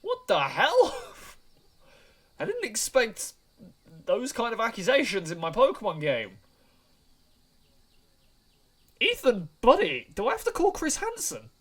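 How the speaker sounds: loud, high, incredulous cry; shocked outburst. Real, not acted. Player scandalised by the game's accusations, loud shocked outburst. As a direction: exclaim in amused disbelief, pitch shooting up.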